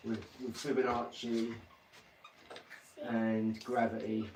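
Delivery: soft, slow mock-professor reciting jargon; mock genius. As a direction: pretend to be a hushed, pondering genius. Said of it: "next minute you're talking".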